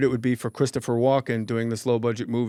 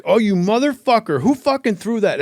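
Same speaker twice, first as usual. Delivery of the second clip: high, loud furious yell at the crowd; angry yell; 9 semitones higher, 6 dB louder than usual. Retold or imitated. Comic yelling at a heckler who hit him with ice. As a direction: bark it out, outraged. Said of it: "You're mad".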